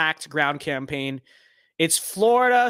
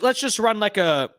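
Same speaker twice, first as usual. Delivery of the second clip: usual speaking voice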